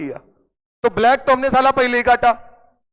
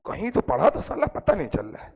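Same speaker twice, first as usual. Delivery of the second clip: 1 baffled mumble; 4 semitones lower, 8 dB quieter than usual. Retold or imitated. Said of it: "अब वो क्या कह रहा मालूम?".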